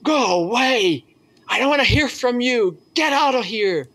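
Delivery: high, strained cry to get away; a prisoner shouting at the priest to leave; retold shout. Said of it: "the man started to shout".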